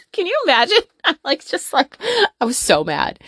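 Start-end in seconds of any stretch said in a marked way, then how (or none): 0.0-2.0 high-pitched, laughing disbelief. Real, not acted. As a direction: talk through a fit of giggles. Cracking up at her own story.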